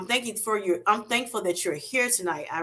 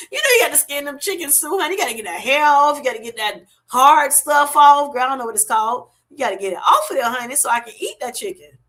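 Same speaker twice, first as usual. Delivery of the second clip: higher, louder and fast, laughing through it; laughing excited rant; 5 semitones higher, 6 dB louder, faster than usual. Real, not acted. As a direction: get worked up and laugh about skinning chicken. Laughing rant about skinning chickens.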